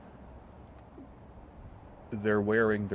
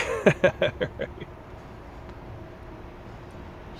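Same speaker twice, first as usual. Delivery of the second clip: high breathy laugh; 12 semitones higher than usual. Real, not acted. Laughing at own story.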